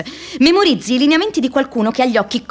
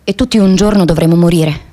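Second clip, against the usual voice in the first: solemn monologue; 2 semitones lower than usual. Acted. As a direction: lay each line down with gravity. Closing monologue delivered.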